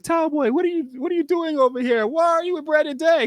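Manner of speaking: crowd member in a squeaky falsetto; heckling fan voice, high and teasing; high mock crowd voice. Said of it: "it's like".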